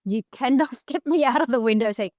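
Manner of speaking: ordinary talk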